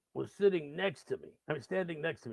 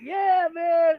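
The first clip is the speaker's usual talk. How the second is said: high, loud cheering yell; mock elation; 14 dB louder than usual. Storyteller playing along with the drunk, high mock cheer. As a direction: whoop along at full pitch.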